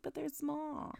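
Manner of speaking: small, high, soft voice; self-conscious heroine. Retold or imitated. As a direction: squeak it softly, like a shy complaint. Voicing the heroine's shy reply.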